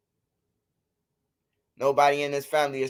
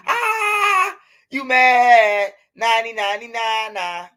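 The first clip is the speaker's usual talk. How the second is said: mocking laugh; 7 semitones higher, 5 dB louder, slower than usual. Mocking a troll on stream.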